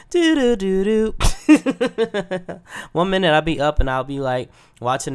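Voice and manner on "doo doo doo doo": high sing-song startup jingle; high, louder "doo doo" reboot tones; imitated computer boot chime. Likening a sleepy body to Windows XP, imitated boot chime. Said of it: "when it reboots to be".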